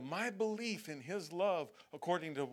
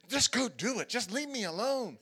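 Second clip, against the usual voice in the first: higher, louder exasperated parent; fed-up parent; 7 semitones higher, 5 dB louder than usual. Retold or imitated. A worn-out parent giving in to the whining child. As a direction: snap it off like a worn-out dad. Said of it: "all of a sudden you just say".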